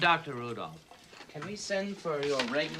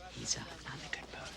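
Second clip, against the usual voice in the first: quick hushed whisper aside; 9 dB quieter, much faster than usual. Real, not acted. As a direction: whisper it fast so he can't hear. Aside warning about the boss.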